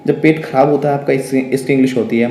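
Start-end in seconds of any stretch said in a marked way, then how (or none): none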